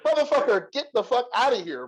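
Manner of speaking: high-pitched mock exasperation; exasperated retort. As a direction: send him packing in a high, incredulous voice. Retold retort to the hillbilly.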